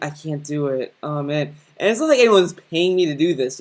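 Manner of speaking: usual speaking voice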